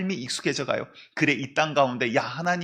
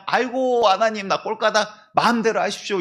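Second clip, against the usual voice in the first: hammed-up despairing cry; 7 semitones higher, 5 dB louder than usual. Jonah giving up dramatically.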